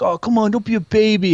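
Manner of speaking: high, loud mocking taunt; taunting cousin. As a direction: goad the scared kid. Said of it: "he's just like".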